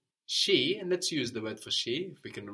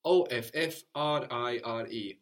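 spelled out letter by letter. Shown as a demonstration. Teacher spelling offrire for the class. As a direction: spell out O F F R I R E one letter at a time. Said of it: "spelling out offrire".

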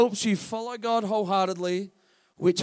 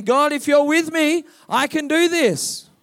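higher, much louder shout of faith; bold declaration; 7 semitones higher, 10 dB louder than usual. Shown as a demonstration. Preacher modelling a bold prayer for the church, loud and triumphant. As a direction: proclaim it triumphantly. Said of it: "start saying".

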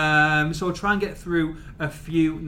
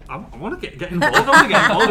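mock-eager pleading, rising higher and louder; imitating Swans; 6 semitones higher, 7 dB louder, much faster than usual. Host mimicking Swans wanting in on the trip, mock-eager. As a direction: play an over-keen hanger-on, building in volume. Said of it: "Swans are like".

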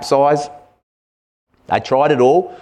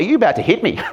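higher, startled question, half-acted; 9 semitones higher, much faster than usual. Preacher voicing Jesus in the painting, startled and high. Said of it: "It's almost startled".

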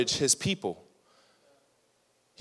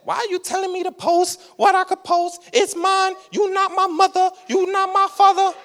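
much higher, whiny, defiant put-on voice; mocking upset teen; 3 dB louder than usual. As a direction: parody of a kid talking back to a leader. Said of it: "And we get upset".

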